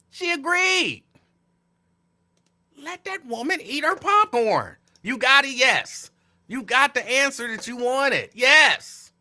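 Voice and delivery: high, building to a shouted yes; rising exasperated rant. Real, not acted. To viewers about the kicked-off woman, exasperated shouting. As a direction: push harder each line until the yes bursts out.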